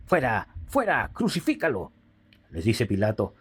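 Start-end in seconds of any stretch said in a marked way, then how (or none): 0.1-1.9 mild narrated outcry. A narrator's reading. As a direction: call it out with restraint, as a reader. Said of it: "Entonces ellos gritaron". (grito contenido de lector)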